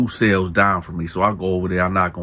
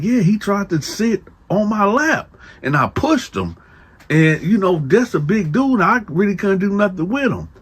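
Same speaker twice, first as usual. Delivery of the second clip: a hint of a higher, pleading young man; lightly voiced young cellmate; 12 semitones higher than usual. Cellmate telling Dante his trouble, lightly pitched up. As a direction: raise the pitch for the worried kid. Voicing Lil Man's complaint.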